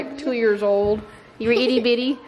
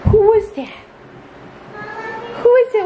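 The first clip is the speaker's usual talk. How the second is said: excited; 9 semitones higher than usual. Real, not acted. Playful greeting to toddler.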